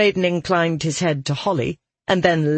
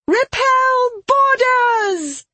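very high, drawn-out captain's shout; shouted battle order; 4 dB louder, much slower than usual. Acted. Marrow orders the crew to fight off attackers, high shout. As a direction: stretch the command into a high, gleeful yell. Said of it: "Marrow shouted".

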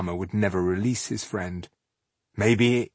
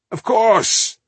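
gleeful; 14 semitones higher, 7 dB louder than usual. Acted. Teasing gleeful reply.

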